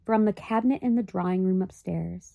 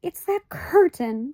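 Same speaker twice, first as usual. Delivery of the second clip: cried out; 8 semitones higher, slower than usual. A narrator's reading. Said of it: "cried Homley".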